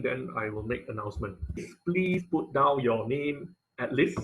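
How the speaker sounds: ordinary delivery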